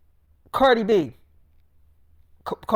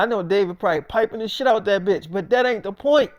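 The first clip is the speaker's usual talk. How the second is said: ordinary talk, nothing marked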